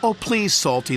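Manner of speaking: chiming plea. A narrator's reading. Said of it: "they chimed".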